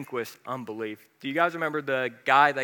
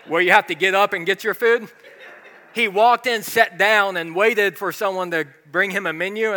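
laughing; 6 semitones higher, 9 dB louder than usual. Real, not acted. Laughing while telling funny story.

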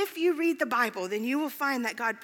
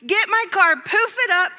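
much higher and louder, impatient pleading; demanding prayer; 7 semitones higher, 8 dB louder than usual. Retold or imitated. Late driver begging God in the car, loud and impatient. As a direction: demand the miracle loudly, almost yelling. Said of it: "This is how I was actually praying".

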